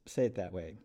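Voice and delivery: normal talking voice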